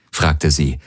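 plain reading voice

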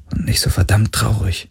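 a hushed, voiceless, sorrowful close; sad whisper. Acted. Girai admits to Galen that life is sad, in a whisper. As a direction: drop to a whisper on the sadness.

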